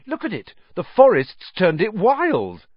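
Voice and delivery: gleeful, high-pitched amazement; delighted. A narrator's reading. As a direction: show it off in excited wonder. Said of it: "said Ron delightedly".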